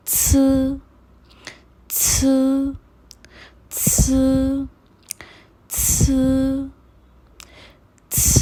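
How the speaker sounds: छ as an airy, voiceless puff; pinyin c sound. Shown as a demonstration. Teacher modelling pinyin c, airy छ. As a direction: puff छ out through the teeth. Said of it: "सी को pronounce करने का तरीका".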